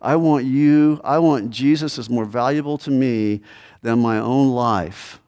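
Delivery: ordinary delivery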